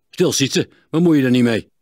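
put-on sharp loud snap at the boy; snapping. A narrator's reading. Smith silences his son, loud snap. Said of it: "snauwde Klinkhamer".

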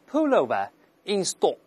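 "pullover in stock" with no final r, rounded "stock"; careful British model phrase; British accent. Shown as a demonstration. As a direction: say it British: pull-oh-vuh in stawk. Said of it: "英式发音嘛".

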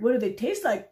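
loud, scornful parroting with a falling end; mocking. Pigeon parroting the duck's question mid-rant, mocking. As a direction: parrot the question loudly, with contempt.

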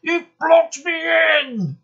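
high, furious shout; loud, high-pitched fury. A narrator's reading. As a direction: shout it in outrage. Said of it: "he was furious".

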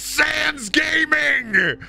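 high, drawn-out mock shout; hammed-up announcement. Host announcing Undertale guest, hammed-up high shout. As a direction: stretch it into a high, silly holler.

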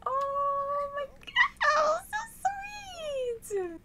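very high, long drawn-out squeal; gushing squeal. Real, not acted. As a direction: stretch it out, overcome with delight. Gushing over the proposal.

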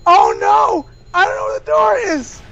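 high frantic yell; panic, running out of time; panicked. Real, not acted. Panic in game timer.

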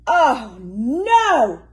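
high, loud near-crying "oh no"; almost in tears with tiredness; mock anguished cry; despairing wail. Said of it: "said Mister Bear".

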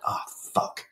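breathy under-the-breath oh fuck, voice dropped away; whispered curse. Retold or imitated. Retelling realizing he never told Jack, whispered curse. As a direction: let it slip out as a whisper of dread.

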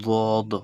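বদ with a heavy, emphatic ظ-like sound. Shown as a demonstration. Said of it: "ভারী হচ্ছে".

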